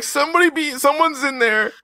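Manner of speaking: high, crying plea; mock sobbing call for Tug; high-pitched wail; whiny panic. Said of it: "and he's like".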